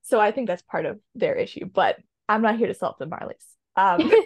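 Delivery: ordinary talk, nothing marked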